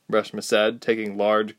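ordinary delivery

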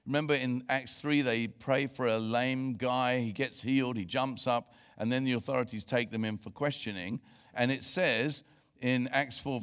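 ordinary delivery

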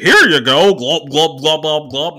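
loud, high "here you go" with pouring glops; mock pushy server. Comic mocking careless servers, loud with pouring noises. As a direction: slosh the wine and chant the glops. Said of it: "servers will be like".

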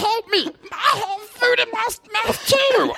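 high panicked choking. Pretend.

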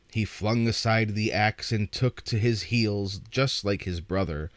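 usual speaking voice

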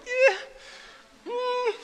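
high-pitched doubtful noises; skeptical hedging. Retold or imitated. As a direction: squeak out your doubt, unconvinced. Doubtful reaction to a claim.

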